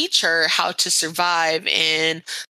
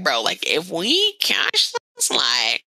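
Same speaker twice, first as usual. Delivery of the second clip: hammed-up complaining; 5 semitones higher than usual. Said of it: "So they were like".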